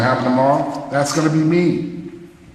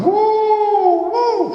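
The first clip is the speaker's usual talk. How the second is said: high hooting woo woo, louder; excited whoops; 3 dB louder than usual. Retold or imitated. Musician cheering a bandmate's bass line, high excited whoops.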